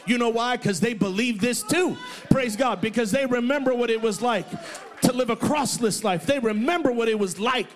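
loud, climbing shouted preaching; full-throated pulpit crescendo; preaching at full force. Acted. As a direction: lift the room with a rising shout.

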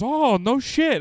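loud, high New York exclamation; hammed-up New Yorker's surprise; the stranger answering Mike in a thick New York accent; New York guy. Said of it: "I can hear him in the background".

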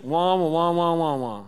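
loud muffled wah-wah drone; droning teacher. Retold or imitated. Teachers lecturing the kids, loud muffled wah-wah. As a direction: honk out the lecture like a muted horn. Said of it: "Like, teachers".

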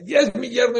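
high-pitched rival claim, mock outrage; squabbling mother-in-law. Second mother-in-law tugging the groom, high mock quarrel. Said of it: "se estaban peleando entre los dos".

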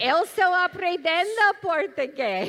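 halting Portuguese with English accent; stumbling learner Portuguese, English accent. Real, not acted. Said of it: "attempt to speak Portuguese".

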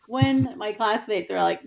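normal talking voice

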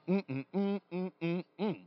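string of short admiring "mm" hums. Retold or imitated. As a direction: hum your approval, mm after mm. Admiring the actor all flight.

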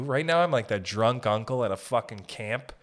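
usual speaking voice

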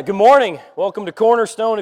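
ordinary talk, nothing marked

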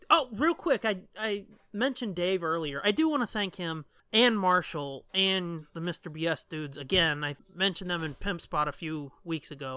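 ordinary talk, nothing marked

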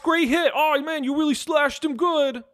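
high, goofy put-on accent; chirpy sidekick cheering on the boss; silly Vankar accent. GM voicing Vankar cheering his boss, high goofy accent. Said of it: "I need a new accent for Vankar".

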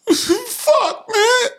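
very high, cracking laughter through words; laughing hard. Real, not acted. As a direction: crack up mid sentence. Laughing fit after roast.

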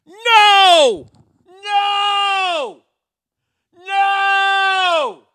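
a huge comic howl of outrage at a name; furious shout. Acted. Host yelling at the hurricane list, a furious shout. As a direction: scream no with everything you've got.

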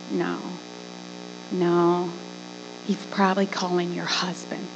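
low, soft discouragement; subdued, patronizing brush-off; well-intentioned Christians. Acted. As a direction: let them down quietly and low. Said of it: "I had well-intentioned Christians saying".

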